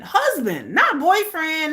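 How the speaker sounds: ordinary talk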